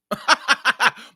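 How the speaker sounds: laugh. Real, not acted. Laughing at own story.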